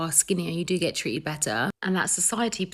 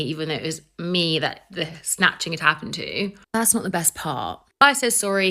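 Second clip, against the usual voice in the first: normal talking voice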